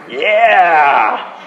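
big drawn-out, gleeful yeah; triumphant cheer. Acted. Preacher celebrating Joseph on the throne, triumphant cheer. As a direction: cheer the twist with relish.

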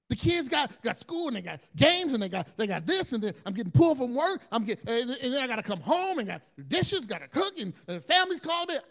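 high, loud, fast list of burdens; frazzled rant. Retold or imitated. Busy parent venting chores to Jesus, high and frazzled. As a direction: rattle it off overwhelmed.

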